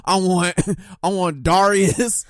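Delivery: high, loud pleading through laughter; mock demanding fan. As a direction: insist like an over-eager fan. Playful demands, friend cuts him off.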